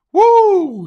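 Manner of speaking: high, loud excited whoop; excited woo. Real, not acted. Host hyping a viewer's comment with a big excited woo. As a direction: let out a big celebratory woo.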